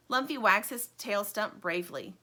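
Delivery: plain reading voice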